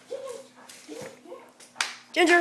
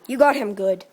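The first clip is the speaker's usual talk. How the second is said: ordinary talk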